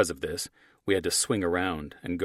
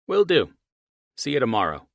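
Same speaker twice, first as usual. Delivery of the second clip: much higher, louder cheerful signoff; ending call; 6 semitones higher, 8 dB louder than usual. Acted. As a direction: brightly wrap up the call. Archie ends call.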